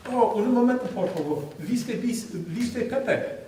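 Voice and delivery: playfully retold question in halting Spanish; mock Spanish inquiry.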